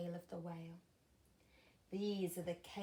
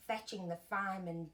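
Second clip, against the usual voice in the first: ordinary delivery, nothing marked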